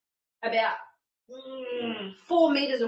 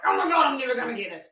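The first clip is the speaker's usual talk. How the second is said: fast, louder freak-out; mock panic; 2 semitones higher, 6 dB louder, faster than usual. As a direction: imitate freaking out in a quick burst. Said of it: "Panicking and freaking out".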